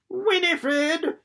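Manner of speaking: high anxious call from afar; high worried granny calling Winifred. Acted. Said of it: "an anxious voice called".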